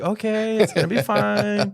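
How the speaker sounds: high, sing-out fake composure; mock-reassuring parent; forced cheer. Forced calm on returning to kids.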